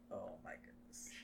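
soft, unvoiced whisper. Real, not acted. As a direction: whisper it under your breath. Whisper reacting to cringe.

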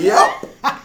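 a high, laughing squeak of a yep; squeaky yep. Retold or imitated. Player answering the coach, squeaking high while laughing. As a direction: fake being fine with a squeak. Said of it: "I was like".

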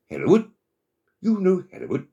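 very high, loud surprised question; storybook frog amazed, voice leaping up; astonished frog. A narrator's reading. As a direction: exclaim in high-pitched disbelief.

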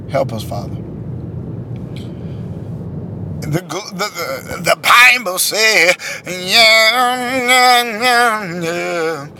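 hoop it like a preacher; preacher hooping again. Retold or imitated. Mocking a preacher hooping at the pulpit, slow and drawn out. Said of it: "I love hooping".

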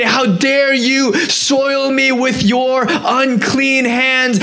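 high, slow, indignant rebuke; mock angry yell. Preacher voicing Jesus rebuking the unclean woman, mock angry yell. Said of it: "he's gonna yell at me and say".

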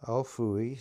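usual speaking voice

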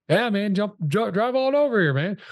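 high, eager invitation, retold; cheering him to come over right now; excited yes. Retelling inviting the top producer over, eager and high.

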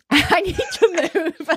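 high, slowed words breaking into laughter; laughing. Real, not acted. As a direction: laugh it out in disbelief. Laughing at the advice to move.

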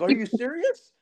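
high-pitched innocent disbelief; imitated naive friend. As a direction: mimic an oblivious friend's surprise. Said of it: "He's just, like, naive".

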